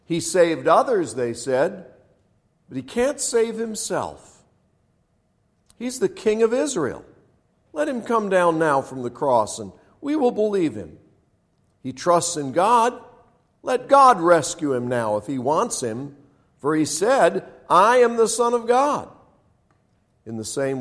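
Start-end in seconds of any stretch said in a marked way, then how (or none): 0.1-1.1 loud, raised-pitch sneer; mock him before the crowd. A narrator's reading. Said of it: "the elders mocked him". 2.7-19.1 high, loud taunting of a condemned man; mocking. A narrator's reading. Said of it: "the elders mocked him".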